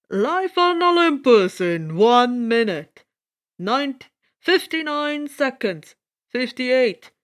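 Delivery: loud announcer voice over a PA; booming loudspeaker. Acted. Loudspeaker announcing the Olympus broadcast, booming announcer voice. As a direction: boom it like a broadcast countdown. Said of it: "A loudspeaker voice boomed".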